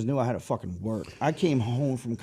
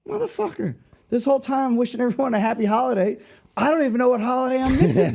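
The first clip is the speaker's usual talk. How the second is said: much higher, louder frustrated outburst; disbelieving outburst; 11 semitones higher, 5 dB louder than usual. Retold or imitated. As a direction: blow up in disbelief at the whole mix-up. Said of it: "I'm like".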